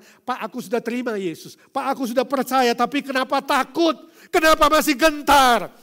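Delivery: loud, high preacher's cry; preaching at full force. Acted. Pastor crying out God's power over all to the congregation, loud and high. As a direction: proclaim it to the whole hall.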